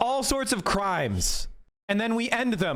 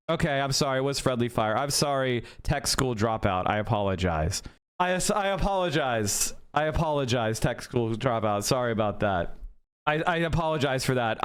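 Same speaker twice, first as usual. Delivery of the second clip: sarcastic apology; 7 semitones lower than usual. Real, not acted.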